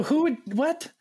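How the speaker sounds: high, rising incredulous question; feigned shock. Retold or imitated. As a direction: act baffled, pitch leaping up. Feigns shock at his own prank.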